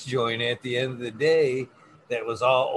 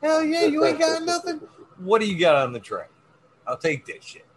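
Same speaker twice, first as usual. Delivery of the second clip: excited; 14 semitones higher than usual. Retold or imitated. Acting eager roach collector.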